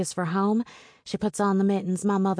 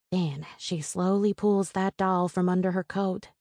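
ordinary delivery

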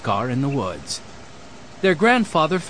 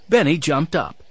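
ordinary delivery

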